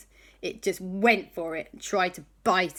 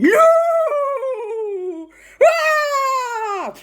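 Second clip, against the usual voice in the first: very high, loud wail of despair; anguished cry; 10 dB louder than usual. Acted. The ghost learning of the moon landing, loud wail. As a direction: wail as if heartbroken.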